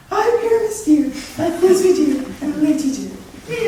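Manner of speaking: high, slow tiny lady voice; monstrous little voice. Acted. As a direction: squeak it out slow and dainty. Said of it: "I will speak in a monstrous little voice".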